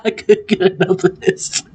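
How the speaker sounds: talking through laughter, pitched high; helpless laughter. Real, not acted. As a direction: say it while cracking up. Cracking up at his impression.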